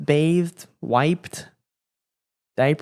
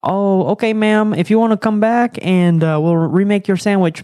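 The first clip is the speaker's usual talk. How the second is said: higher, accommodating customer-service tone; polite worker voice; 6 semitones higher, 5 dB louder than usual. Retold or imitated. Worker offers to remake the sandwich, higher polite voice. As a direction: placate the caller sweetly. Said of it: "they'll be like".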